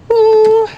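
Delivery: high, loud whoop; playful "woo". Retold or imitated. Mimics child's one-legged ballet pose.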